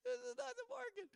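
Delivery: high, squeaky protest that this is not the market; exasperated tourist. Retold or imitated. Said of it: "And I'm like".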